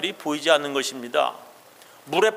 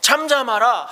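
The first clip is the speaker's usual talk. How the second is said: rebuking; 6 semitones higher, 4 dB louder than usual. Acted. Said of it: "꾸짖어".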